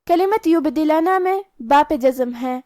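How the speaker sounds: ordinary delivery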